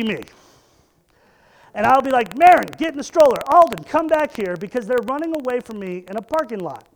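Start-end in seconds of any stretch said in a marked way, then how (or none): 2.4-4.5 put-on loud, fast call to run-off kids; yelling at kids. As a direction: bark the kids' names across a parking lot. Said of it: "screaming at two kids".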